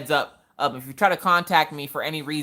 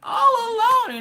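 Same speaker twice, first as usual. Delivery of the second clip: hammed-up sob, much higher, slow and wailing; mock crying; 4 dB louder, much slower than usual. Clingy wife to leaving husband, mock sobbing wail. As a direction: whine it like an abandoned puppy. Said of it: "and I'm like".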